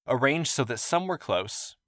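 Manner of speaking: ordinary delivery, nothing marked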